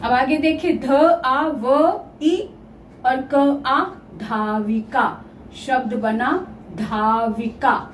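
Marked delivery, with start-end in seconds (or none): 0.8-3.8 धाविका split into ध, आ, व, ई, क, आ; each sound given separately, with short gaps; sound-by-sound breakdown. Shown as a demonstration. 6.7-7.9 धाविका drawn out slowly and pitched lower; slow, low dictation of धाविका; slow syllable-by-syllable word. Shown as a demonstration. Teacher repeats new word slowly.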